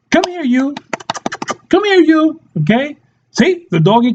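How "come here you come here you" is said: high pet-calling voice; calling a dog. Shown as a demonstration. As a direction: coax the doggy over in a high pitch. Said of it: "You know, for your doggy".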